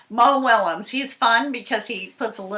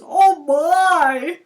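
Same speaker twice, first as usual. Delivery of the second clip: higher, louder surprised gasp; playful shock; mock surprise; 6 semitones higher, 7 dB louder than usual. Real, not acted. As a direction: gasp at the picture. Reacting to fox in pot.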